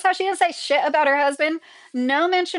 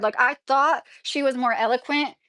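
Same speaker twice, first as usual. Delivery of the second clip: ordinary talk, nothing marked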